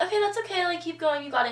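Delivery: high, bright coaxing; 1 encouraging. Retold or imitated. Interviewers coaxing the nervous girl through her poses, high encouragement. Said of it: "and then they're like".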